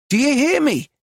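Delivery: high-pitched, urgent question; anxious demand. A narrator's reading. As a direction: demand a reply, voice pitched right up. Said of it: "Her voice sounded anxious".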